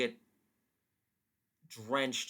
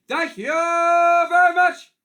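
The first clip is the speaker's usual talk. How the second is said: high, loud and slow; over-the-top comic sign-off; 13 semitones higher, 13 dB louder, slower than usual. Acted. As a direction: belt it like a lounge comic.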